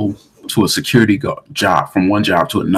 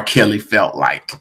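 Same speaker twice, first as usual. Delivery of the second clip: usual speaking voice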